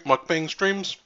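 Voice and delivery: usual speaking voice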